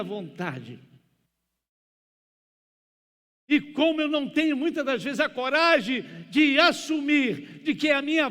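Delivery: loud, high, fervent sermon delivery; full-force preaching. Real, not acted. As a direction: preach it hard in driving, urgent phrases.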